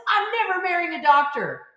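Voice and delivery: high, loud, flat refusal; defiant teen declaration. Retold or imitated. Teenage daughter telling her parents, loud defiant refusal.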